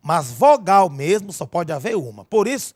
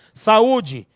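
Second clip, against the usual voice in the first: "Saúde" with a strong, higher U as its own syllable; stressed U in saúde; 5 semitones higher, 3 dB louder than usual. Shown as a demonstration. Teacher showing students a strong vowel, stressed U in "Saúde". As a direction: lean on the U, sa-Ú-de, in "Saúde". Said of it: "o U aqui é forte".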